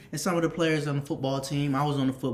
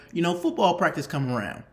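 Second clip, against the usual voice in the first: ordinary delivery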